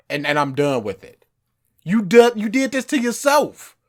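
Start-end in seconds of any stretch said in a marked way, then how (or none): 1.8-3.8 high, loud and emphatic; fired-up insistence; heated plea. Real, not acted. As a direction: drive the point home with fervor. Passionate argument plea.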